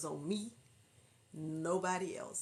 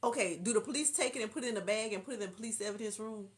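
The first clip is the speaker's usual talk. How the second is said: ordinary talk, nothing marked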